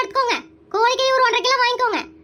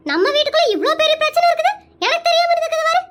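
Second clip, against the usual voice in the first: high, fast, worked-up fretting; agitated complaint; 8 semitones higher than usual. Acted. Aunt upset at being kept in the dark, high and agitated. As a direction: rush it out, pitch climbing in exasperation. Aunt frets about secret family problem.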